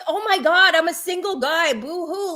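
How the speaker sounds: high-pitched whiny mimicry, fading on the end; mock him as a self-pitying crybaby; exaggerated poor-me whine; mock whining. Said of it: "what he was whining about".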